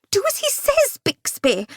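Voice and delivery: high, panicky lady's wail. Acted. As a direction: wail at the servant in fright. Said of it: "Dahlia wailed".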